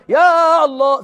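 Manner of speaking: high, drawn-out mock cry to God; mock yelling prayer. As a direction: imitate someone bawling out a prayer. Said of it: "انت بتزعق لربنا؟".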